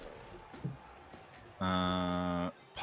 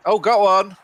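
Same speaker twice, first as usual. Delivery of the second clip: excited outburst; 13 semitones higher, 9 dB louder than usual. Real, not acted. Sudden excited outburst mid-sentence.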